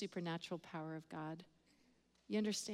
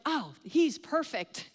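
higher, drawn-out mock delight; delighted exclamation; 4 semitones higher, 4 dB louder, slower than usual. Friend spotting her prayer target, imitated delight. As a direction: imitate an eager friend, stretching the words. Friend spots someone to pray for.

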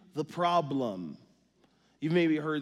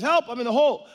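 much higher and louder cry for help; calling for help; 10 semitones higher, 10 dB louder, faster than usual. Acted. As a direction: yell up out of a hole. Man in hole yelling.

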